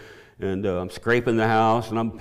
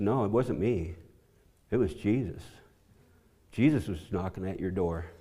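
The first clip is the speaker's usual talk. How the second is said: humble; 3 semitones lower, 9 dB quieter than usual. Retold or imitated. Humbly deflecting praise to Jesus.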